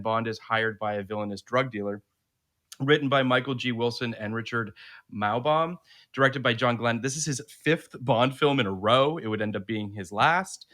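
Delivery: steady read-aloud of a synopsis; reading a review aloud. Acted. Host to listeners, reading out the film synopsis.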